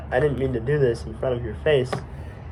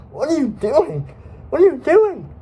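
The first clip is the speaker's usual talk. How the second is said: panicked protest; 12 semitones higher than usual. Acted. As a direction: demand an answer in mounting panic. Startled cry during sudden attack.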